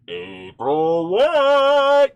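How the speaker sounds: slow, loud, high name announcement; announcer callout. Acted.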